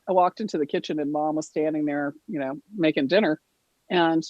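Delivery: usual speaking voice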